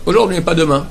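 usual speaking voice

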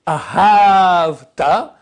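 "Ve'ahavta" very slow, ahav stretched and strong; stress on second syllable. Shown as a demonstration. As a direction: hammer and hold hav in "Ve'ahavta". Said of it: "l'accent sur la deuxième syllabe".

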